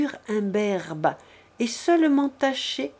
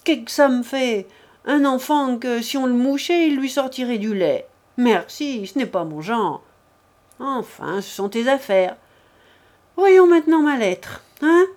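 lightly acted brash maid, higher pitched; a touch of cheeky, offhand dismissal; dismissive Adèle figure; 4 semitones higher, 6 dB louder than usual. A narrator's reading. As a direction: toss it off with mild scorn, then briskly press on.

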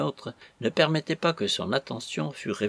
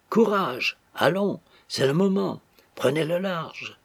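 lightly acted urging, pitched higher; 6 semitones higher than usual. A narrator's reading. Captain pressing the bridegroom to escape, urgent encouragement. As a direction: egg him on with a lifted voice.

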